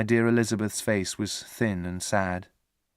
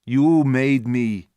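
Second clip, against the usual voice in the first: slow, louder monster voice; monster's reply; 2 semitones higher, 6 dB louder, much slower than usual. A narrator's reading. Monster answering Victor, slow and heavy. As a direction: drag the words out heavily. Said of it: "the monster answered".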